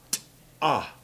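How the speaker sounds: T-AH as a held-breath t, a break, then ah; aspirated t. Shown as a demonstration. Teacher showing how the quotation marks sound, aspirated T-AH. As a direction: say T-AH in two parts, T released apart from AH. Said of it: "hold your breath and go".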